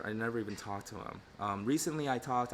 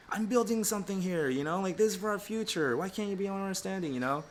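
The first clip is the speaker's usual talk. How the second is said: defensive protest; 8 semitones higher, 4 dB louder than usual. Retold or imitated. Retold argument with wife.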